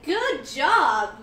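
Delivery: loud, swooping praise; cheering congratulation. Real, not acted. Host congratulating viewer on graduating, cheering. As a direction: belt out the praise, stretching it.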